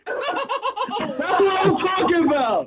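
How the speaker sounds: high-pitched triumphant cheer; elated. Real, not acted. Tim celebrates after winning a second date, elated.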